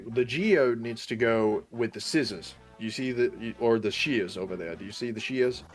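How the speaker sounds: ordinary talk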